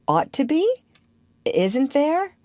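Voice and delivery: high, rising "Ought to be?" as a surprised question; puzzled query, "be" rising high; questioning echo. Acted. Wendy questions what Peter said.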